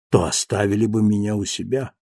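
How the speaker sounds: usual speaking voice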